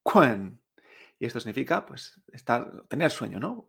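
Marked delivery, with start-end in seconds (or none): 0.0-0.6 "kun" as kwen, dropping in pitch; fourth tone. Shown as a demonstration. Teacher repeating for learners, "kun" as falling kwen. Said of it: "En cuarto tono".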